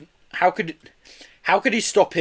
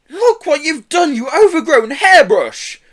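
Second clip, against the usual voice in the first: high, loud, slow outraged scolding; furious yell; 9 dB louder, slower than usual. A narrator's reading. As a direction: let her have it, furious. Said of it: "yelled Joe".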